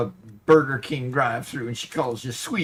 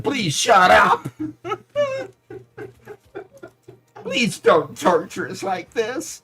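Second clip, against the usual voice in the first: drawled Southern plea, louder and higher; 7 semitones higher, 5 dB louder than usual. Pretend. Streamer pleading as a voiced viewer in a Southern drawl. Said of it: "I think Robert's saying".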